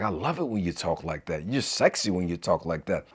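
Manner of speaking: soft, low, flirty praise to his wife; soft flirty compliment. Retold or imitated. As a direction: murmur it lovingly to your wife. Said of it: "I say it sometimes too".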